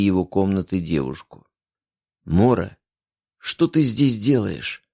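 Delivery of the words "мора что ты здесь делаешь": slow, puzzled question; surprised. A narrator's reading. Man startled to find her in his room, slow surprised question. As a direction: ask as if caught off guard. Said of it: "удивлённо спросил он".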